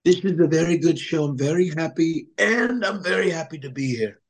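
doing Trump for the podcast host who asked for it; Trump impression. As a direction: boast like Trump at a rally. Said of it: "I love doing Trump".

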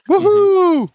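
high, loud cheer; excited whoop. Pretend. Host cheering a returning caller, excited high whoop. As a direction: let out a big woohoo.

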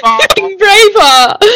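high, slow words through laughter; laughing. Real, not acted. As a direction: squeeze it out while crying with laughter. Laughing after the impression.

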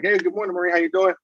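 light, high friendly hello; breezy, easygoing hello; very casual greeting. Retold or imitated. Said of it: "being very casual".

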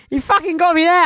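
loud exclaiming. Retold or imitated. Retelling her own shout.

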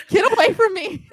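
high laughing yell; laughing shout. Retold or imitated. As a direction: shout it away through laughter. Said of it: "And I was like".

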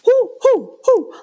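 high, loud cheering hoots; cheering whoops. Retold or imitated. Traveler cheering on screaming kids on planes, loud whoops. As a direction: whoop like a fan in the stands. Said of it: "just cheering on".

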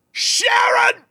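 very high, strained mock holler; loud street call. Desperate man hollers into the street for keys, imitated. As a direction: yell desperately for someone far away. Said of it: "he says loudly into the street".

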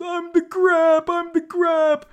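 high, loud, drawn-out mock wail; hammed-up anguished squeal; Danny DeVito. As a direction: cry it out in a very high comic voice. Acting out DeVito's anguished reply.

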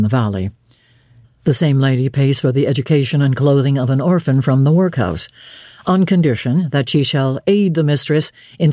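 earnest churchman, low and steady; solemn clergyman. Acted. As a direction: speak low and grave, like a serious vicar. St. John explains his offer.

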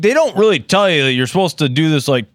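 ordinary delivery

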